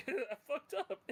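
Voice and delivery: high, slowed, giggling I fucked up; breaking into laughter while quoting; laughing mock despair. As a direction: laugh through a mock confession. Echoing the character's despair.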